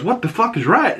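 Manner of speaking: mock father raising his voice up high; indignant echo. Dad catching daughter, high mock retort. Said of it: "He goes".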